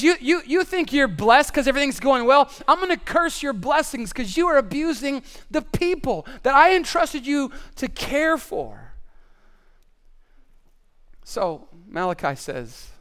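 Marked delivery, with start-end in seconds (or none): 0.0-8.9 raised, emphatic scolding, slowing at the end; lightly acted divine rebuke; angry God. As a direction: scold the priests in a higher voice, stretching the last words. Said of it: "so God gets so angry".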